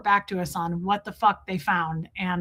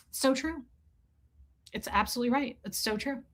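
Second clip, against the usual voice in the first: murmured agreement; 8 dB quieter than usual. Retold or imitated.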